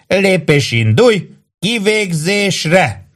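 high, stretched-out drill command; high-pitched parade call, no real force; mock bellowed order. A narrator's reading. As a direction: draw out the final order theatrically. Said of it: "üvöltötte az alak".

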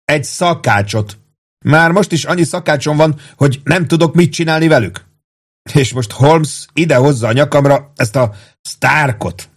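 fed-up sergeant airing his grievance; impatient outburst. A narrator's reading. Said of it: "kiáltotta türelmetlenül Warden".

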